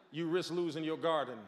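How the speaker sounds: ordinary talk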